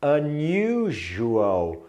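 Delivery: "Unusual" stretched out slowly, the s said like a soft j; slow careful pronunciation. Shown as a demonstration. Teacher to students, drawing out "Unusual" very slowly. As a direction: draw out "Unusual" syllable by syllable. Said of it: "Olha essa aqui a pronúncia".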